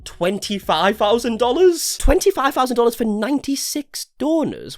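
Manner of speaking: rising disbelief, pitch climbing high; surprised. Real, not acted. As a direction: read it out in disbelief. Reads stunning donation amount.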